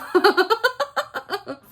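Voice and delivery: high burst of laughter; loud laugh. Real, not acted. Laughing after calling friend asshole.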